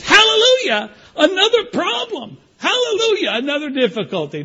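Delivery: high, loud rejoicing cry; joyful hallelujah. Acted. Paul rejoicing in his infirmities, loud high hallelujah. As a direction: burst out praising over every problem.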